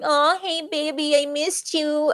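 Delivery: high, syrupy flirting; drawn-out, high-pitched seductive mimicry; fake sweet-talking lover. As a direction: coo it slowly with phony affection. Mimicking two lovers playing each other.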